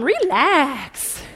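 sassy, drawn-out RELAX; stretched RELAX full of sass; with attitude. Shown as a demonstration. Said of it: "tell him with attitude".

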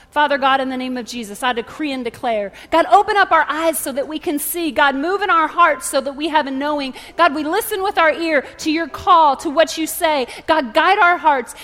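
loud, urgent declaring prayer; impassioned preacher praying aloud; fervent prayer. Real, not acted. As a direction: pray out boldly, pressing each plea. Leads a prayer.

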